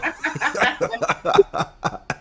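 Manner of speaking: bright laugh burst; high laugh. Real, not acted.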